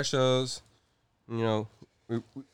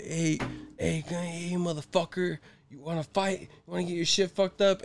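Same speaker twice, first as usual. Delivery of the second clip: imitating wild aggressor; 5 semitones higher than usual. Retelling the man at the car show wanting a fight, mock aggressive. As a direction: act out a riled-up man picking a fight. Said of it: "just starts going wild".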